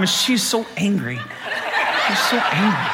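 helpless laughter through the words; laughing. Retold or imitated. Dad recalling his angry daughter, laughing. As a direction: soften and stretch it as laughter takes over. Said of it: "I'm just laughing the whole time".

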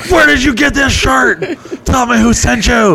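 high, pushy mock interrogator voice; mock suspicious interrogation.

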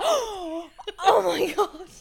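gasping, high and loud; over the top. Retold or imitated. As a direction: gasp like it's huge news. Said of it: "I'm so over the top".

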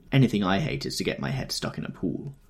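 usual speaking voice

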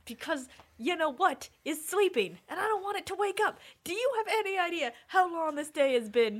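fed up, pitch climbing; exasperated. Acted. In-character exasperated refusal.

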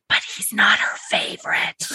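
whispered catty aside; sly whispered gossip; gossipy whisper. Retold or imitated. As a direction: lean in and whisper the dig. Girl leans over, gossip aside.

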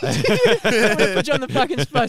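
loud, laughing and teasing. Real, not acted. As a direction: tease him through laughter. Laughing, putting mate on spot.

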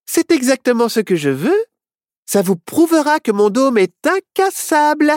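high, cocky little witch; self-satisfied boast; boastful confidence. Acted. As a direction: show off the magic in a high voice. Witch boasts about her dome.

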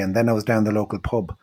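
ordinary delivery

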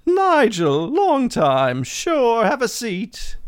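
Margot character voice. Acted.

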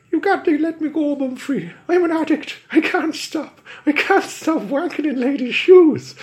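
high, loud, fast pleading stage Irish; leprechaun Irish accent. Acted. Leprechaun begs Bumfrey for release in Irish accent. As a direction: beg in a squeaky Irish leprechaun voice. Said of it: "lay on the accent so thick".

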